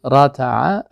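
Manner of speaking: راتع said loudly with a crisp t and a rising end; clear t in راتع. Shown as a demonstration. Teacher drilling t against d, loud crisp t in راتع. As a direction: stress the t in راتع and lift the end. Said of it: "ردع و تفاوت بکن".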